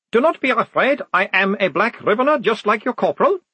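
a timid vampire soothing nervous soldiers; shy vampire. Acted. The vampire iconographer Otto speaks.

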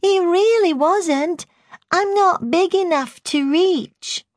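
put-on very high, drawn-out toddler voice; babyish voice. A narrator's reading. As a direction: plead like a little baby, high-pitched. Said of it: "speaking in a babyish voice".

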